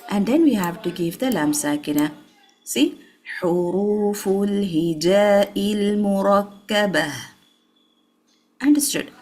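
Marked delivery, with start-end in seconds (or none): 3.4-7.1 "Huruful hijail murakkabah" slow and joined, long vowels held; full timing. Shown as a demonstration. Teacher modelling the whole phrase, fully timed. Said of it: "we have to give time to the hamza".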